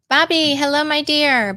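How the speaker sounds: high, loud and delighted hello; light up as a friend joins; excited greeting. Real, not acted. Greeting a viewer on a live stream.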